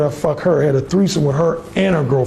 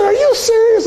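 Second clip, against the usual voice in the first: pitched-up disbelieving female voice; high incredulous woman. Retold or imitated. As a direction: play her shocked and scandalized. Woman reacting on phone.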